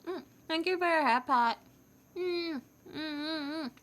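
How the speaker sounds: talking with a mouthful of cookie; cheeks stuffed full. Real, not acted. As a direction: mumble through a mouth full of food. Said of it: "Sorry, mouth is full of cookie right now".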